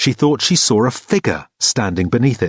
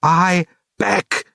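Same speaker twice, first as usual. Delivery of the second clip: shouted; 8 semitones higher than usual. A narrator's reading. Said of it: "he shouted".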